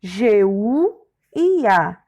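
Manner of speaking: slow, letter by letter; name each letter separately; spelled out. Shown as a demonstration. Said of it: "a palavra guia é escrita". (soletrando devagar, letra por letra)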